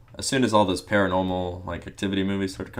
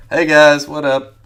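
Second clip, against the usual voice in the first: high, loud hello to viewers; loud greeting; 8 semitones higher, 13 dB louder, slower than usual. Real, not acted. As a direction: call out to the audience. Stream greeting.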